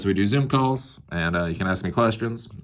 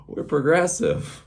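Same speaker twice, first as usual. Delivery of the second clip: sarcastic; 8 semitones higher, slower than usual. Acted. Mocking the town's self-image.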